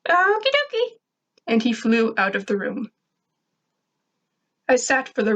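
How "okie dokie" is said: very high, chirpy robot voice; squeaky robot sidekick. Acted. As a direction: sing it out like a happy little bot. Grr voiced leaving.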